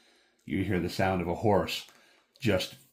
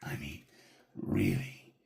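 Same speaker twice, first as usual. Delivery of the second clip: menacing figure; 8 dB quieter than usual. Acted. Figure in the player's face, softly threatening whisper. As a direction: get right in their face, quietly dangerous. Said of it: "he gets right into your face. And he says".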